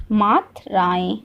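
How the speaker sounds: मात्राएं drawn out in two slow parts, Matru then राएं; teacher's slow dictation of मात्राएं, pausing mid-word; slow, split word. Shown as a demonstration. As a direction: say मात्राएं slowly, breaking it after Matru. Repeats topic word slowly for learners.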